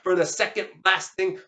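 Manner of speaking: ordinary delivery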